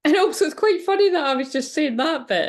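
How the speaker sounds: high, loud, laughing delight; amused laughing. Real, not acted. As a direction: burst out amused at the coincidence, pitched well up. Amused by the coincidence in lyrics.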